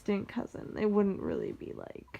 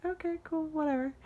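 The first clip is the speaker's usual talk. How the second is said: higher-pitched mock indifference; dismissive; 8 semitones higher than usual. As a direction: shrug it off, higher and lightly. Shrugging off aunts who ignore her.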